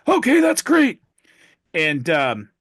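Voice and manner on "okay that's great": very high, loud squeaky reply. Acted. Joke patient agrees to surgery, squeaky high voice. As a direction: squeak it out happily. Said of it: "And he goes".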